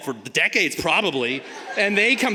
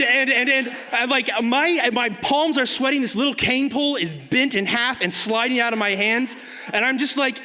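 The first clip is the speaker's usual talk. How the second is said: ordinary talk, nothing marked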